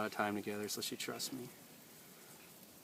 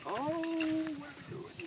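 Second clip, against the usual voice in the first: much higher, slow singsong question; playful dog talk; much slower than usual. Real, not acted. Trainer teasing his dog in a high slow singsong.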